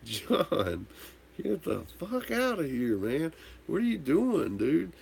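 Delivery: high-pitched startled yell at a friend; mock yell. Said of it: "I'm like".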